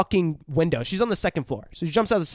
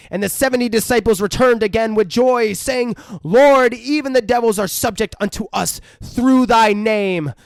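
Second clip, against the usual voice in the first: loud, raised scripture proclamation; mock exorcist preaching; 5 semitones higher, 4 dB louder than usual. Priest driving out the demon, loud mock preaching.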